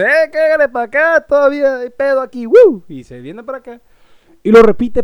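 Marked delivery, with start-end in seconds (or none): 0.0-2.8 high, loud party shout; woo-ing him back to the party, higher and louder; wife calling out. Retold or imitated. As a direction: call him over with a whoop. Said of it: "mi señora le dice".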